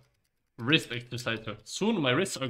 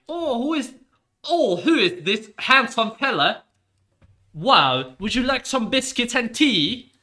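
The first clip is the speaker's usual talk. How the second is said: high, loud, slow, prim parent voice; doting parents; 11 semitones higher, 10 dB louder than usual. Pretend. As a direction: gush over the boyfriend like posh parents. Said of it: "They would be like".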